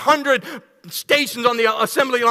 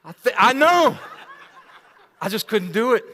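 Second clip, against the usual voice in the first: laughing; 5 semitones higher than usual. Real, not acted. Laughing through confession.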